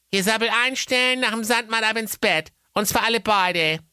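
high mother voice, fondly scolding; sighing, loving mum. A narrator's reading. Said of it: "seufzte liebevoll hinzu".

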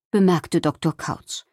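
usual speaking voice

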